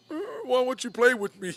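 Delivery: high whimpering pup begging to play; mock dog whimper, pleading; whiny dog voice. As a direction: whine it like a sad puppy. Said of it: "and Ace is like".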